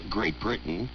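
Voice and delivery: ordinary talk, nothing marked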